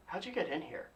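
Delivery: hushed, confidential question; leaning in. Retold or imitated. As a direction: lean in and ask very softly. Retold leaning in to ask.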